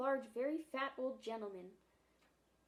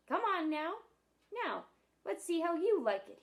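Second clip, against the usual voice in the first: higher, louder mocking challenge; jeering seal; 6 semitones higher, 8 dB louder than usual. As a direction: taunt the children to dive. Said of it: "a bitter, angry voice".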